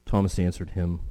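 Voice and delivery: ordinary talk, nothing marked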